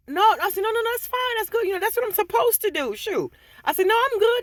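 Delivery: high, loud flustered no, no; turning down a stack of cash, high and flustered; flustered refusal. Retold or imitated. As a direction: push the money away in a high fluster. Refusing the customer's money.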